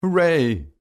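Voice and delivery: high-pitched cheer; a lifted, high hooray; joyful cry. A narrator's reading. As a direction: cheer out in triumph. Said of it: "I cry".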